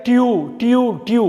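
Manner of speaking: "டியூ" as "tyoo", repeated separately; syllable drill. Shown as a demonstration. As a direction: drill "டியூ" with a clear y-glide. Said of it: "tuition கிடையாது".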